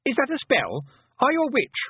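put-on high, urgent child voice; alarmed boy. A narrator's reading. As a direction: fire off suspicious questions, pitched right up. Alarmed boy questions the girl.

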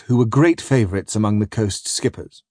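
usual speaking voice

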